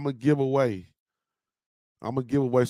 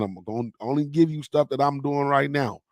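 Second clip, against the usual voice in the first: ordinary talk, nothing marked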